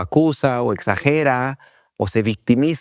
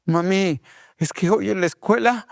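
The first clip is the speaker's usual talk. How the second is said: put-on high whining kid voice, dragging escuela; little kid pleading to mom, pitched up high; whiny child; 8 semitones higher than usual. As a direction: play the child starting a sob story to mom. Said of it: "el niño llega con la mamá y es como".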